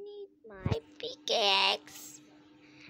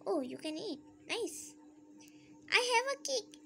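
usual speaking voice

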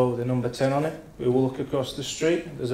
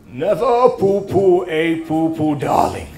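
slow, plummy theatrical drawl, a bit louder; camp Stephen Fry impression; 5 semitones higher, 6 dB louder, slower than usual. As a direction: ham up a luvvie actor. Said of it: "All I could say was".

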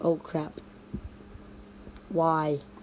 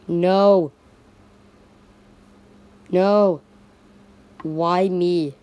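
loud, drawn-out mock despair; dismayed outcry; 8 dB louder, much slower than usual. Character lamenting own bad luck, loud mock despair. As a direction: cry out in hammed-up protest.

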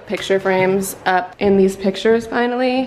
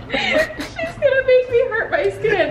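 much higher, half-laughing fake complaint; laughing mock whine. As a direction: whine it as a joke while cracking up. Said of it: "The acting was amazing".

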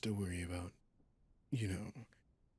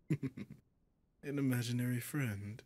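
chuckle, then a higher, slow question; higher-pitched mocking incredulity after a chuckle; amused disbelief; 4 semitones higher, 3 dB louder, slower than usual. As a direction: laugh off the idea, drawing it out. Demon mocks being called imaginary.

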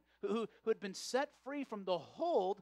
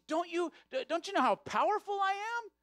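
higher and louder, a hammed-up blustering devil; 7 semitones higher, 5 dB louder than usual. The devil boasting to the old man, a hammed-up high, loud bluster. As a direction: puff up like an offended devil who can't scare anyone.

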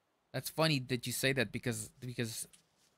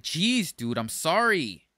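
louder, higher hammed-up outburst, drawn out at the end; mock exasperation; 3 semitones higher, 4 dB louder, slower than usual. Answering an angry imagined stranger, mock exasperation. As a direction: play fed up with having to apologise. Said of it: "start getting angry with me".

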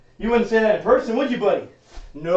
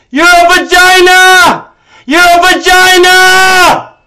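high, loud, slow stretched insult; drawn-out furious yell; 8 semitones higher, 8 dB louder, much slower than usual. Real, not acted. Streamer hurling insult at staff member, slow drawn-out yell. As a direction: drag the insult out at full yell.

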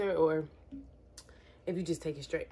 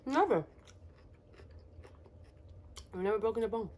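speaking with food in mouth; talking between bites; chewing while talking; 8 dB quieter, much faster than usual. Real, not acted. Seen 1: Lips pursed, jaw working between words after eating lime bite.

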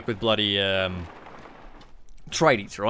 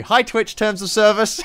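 high, louder cheery cover-up; pitched-up playful backpedal; mock-innocent greeting; 9 semitones higher, 5 dB louder than usual. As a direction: chirp it as a guilty joke. Mock greeting after a joke threat.

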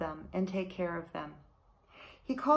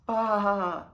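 loud lamb's baa carried on the wind; distant bleat; 5 dB louder than usual. A narrator's reading. Shepherd hears his lost lamb bleating far off. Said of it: "he heard in the distance".

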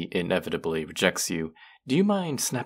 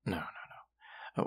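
slow whisper; 11 dB quieter, slower than usual. Acted. Soft correction.

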